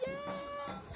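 high, drawn-out squeal of triumph; a soft but very high-pitched yes; celebrating. Retold or imitated. Celebrates the car starting.

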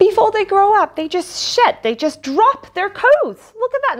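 high, wonder-struck; amazed. Real, not acted. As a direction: gasp and marvel. Amazed at fact.

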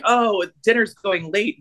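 lightly hammed-up TV-movie actor; bad acting. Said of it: "bad acting and they're like".

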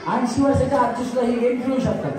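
ordinary talk, nothing marked

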